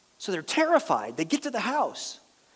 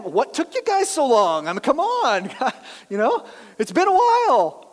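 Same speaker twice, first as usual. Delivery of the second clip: louder, higher easy teasing greeting; breezy Simeon; 5 semitones higher, 6 dB louder than usual. Retold or imitated. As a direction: needle them, you're late. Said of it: "here comes Simeon".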